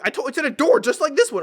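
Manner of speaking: loud, high mock-angry voice; hammed-up fed-up retort; imitated impatient insistence. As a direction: insist as the exasperated old man. Customer loudly repeats himself.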